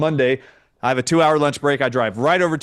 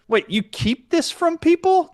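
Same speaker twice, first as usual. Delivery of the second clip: very high, slow disbelieving question; high-pitched astonished accusation; retold incredulity; 14 semitones higher than usual. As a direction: squeak up in appalled disbelief. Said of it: "I'm looking around like".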